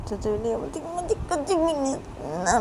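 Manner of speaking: ordinary delivery, nothing marked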